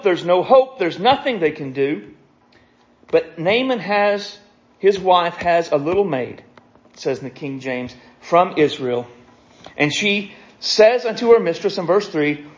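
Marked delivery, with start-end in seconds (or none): none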